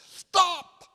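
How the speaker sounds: piercing high yell of "Stop!"; old woman in a wheelchair halting the young pastor, full yell. Retold or imitated. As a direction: shout it at the top of your lungs. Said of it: "she yelled at the top of her voice".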